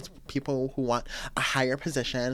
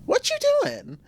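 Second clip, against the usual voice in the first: very high, loud cry of what you doing; a high, loud grandma's mock protest; mock shrill grandma; 5 dB louder than usual. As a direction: squeal it like a scandalized grandma. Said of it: "they'd be".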